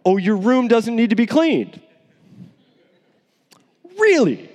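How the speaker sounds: lightly mock a kid's claim; sarcastic parent. Parent sarcastically questioning the kid's messy room. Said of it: "they're like".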